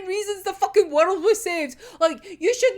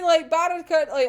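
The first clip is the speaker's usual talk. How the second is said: normal talking voice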